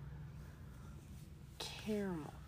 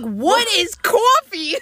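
baffled coworker; 10 semitones higher, 8 dB louder, much slower than usual. Retold or imitated. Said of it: "And she's like".

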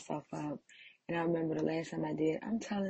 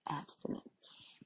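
whispered; 9 dB quieter, slower than usual. Real, not acted. Confessing abstinence attempts.

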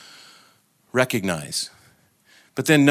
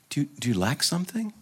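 higher, softer tender question; sweetly questioning; 5 semitones higher, 6 dB quieter than usual. Acted. Quick-eyed love jumps in to the woeful soul, sweetly questioning. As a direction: ask gently, rising at the end. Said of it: "Quick-eyed love jumps right in".

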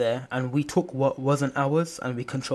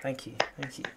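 whispered thanks; 9 dB quieter than usual. Real, not acted. Mock bow after praise.

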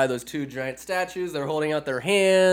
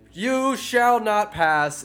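slow, higher booming wizard line; hammed-up Gandalf decree; grand slow wizard proclamation; 6 semitones higher, much slower than usual. Gandalf movie quote.

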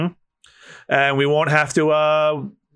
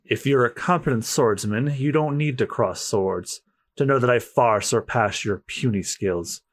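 low, haughty character voice; disdainful master swordsman; 4 semitones lower, 4 dB quieter than usual. Acted. Master swordsman dismissing a challenger's skills, disdainful.

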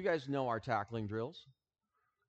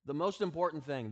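ordinary talk, nothing marked

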